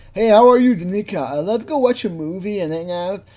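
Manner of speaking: high, loud mock-eager hello; mock greeting. Imagined greeting between James and the girl, loud hammed-up hello. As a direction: overplay an enthusiastic doorstep greeting.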